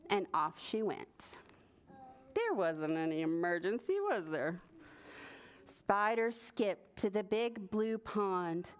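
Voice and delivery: usual speaking voice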